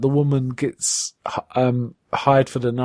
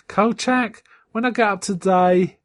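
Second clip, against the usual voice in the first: high mock boss; 8 semitones higher than usual. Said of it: "he says".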